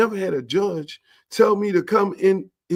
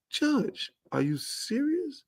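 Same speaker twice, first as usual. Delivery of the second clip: high, soft disbelief; incredulous; 10 semitones higher, 10 dB quieter, slower than usual. Retold or imitated. Campbell asking the judge in high disbelief.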